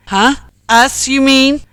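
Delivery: high, loud huffy retort; indignant humph. A narrator's reading. Ken objecting to Jack's explanation, indignant humph. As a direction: humph in affronted protest. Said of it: "humphed Ken".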